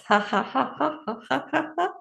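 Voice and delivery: high ha-ha's rising into giggles; laughing sounds. Shown as a demonstration. Teacher leading class through hips exercise, practised high laughter. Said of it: "just making some laughing sounds".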